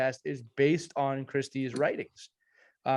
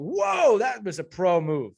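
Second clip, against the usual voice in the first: high, loud "whoa" of awe; amazed reaction; 7 semitones higher, 6 dB louder, slower than usual. Retold or imitated. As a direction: replay your astonishment, louder and higher at first. Retelling amazement at the switch.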